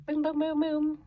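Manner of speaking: high-pitched imitated button beeps; playful high microwave sound effect; microwave button noises. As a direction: punch out high little booms. Imitating microwave buttons being pressed.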